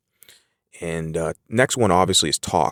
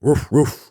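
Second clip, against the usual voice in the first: high, louder mock barking; dog bark; 8 semitones higher, 5 dB louder than usual. Shown as a demonstration. Teacher imitating a dog's bark, high and louder. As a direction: yap it out like a playful dog. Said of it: "bark is typically like a dog".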